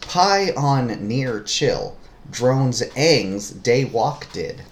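word by word, spaced out evenly; deliberate clue reading. Shown as a demonstration. Reading a puzzle clue the partner must decode, slowly word by word.

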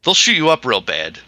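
ordinary talk, nothing marked

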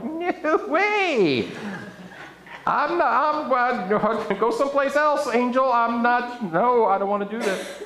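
high, squealing, laughing refusal; girls freaking out. Retold or imitated. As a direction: play a panicked young girl turning the angel down. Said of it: "Even some of the girls are like".

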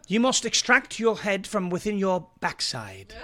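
a mock-polite British gentleman delivering an insult; British accent. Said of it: "We could say it like a British per".

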